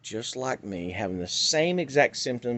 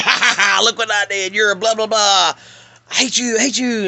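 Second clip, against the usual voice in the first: high, loud cackling taunt; imitating a taunting voice, laughing high and loud; mocking tormentor voice; 9 semitones higher, 8 dB louder than usual. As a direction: jeer like a bully jumping out of a closet. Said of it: "they start mocking and ridiculing you".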